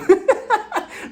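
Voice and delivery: high burst of laughter. Real, not acted. Reacting to her own joke.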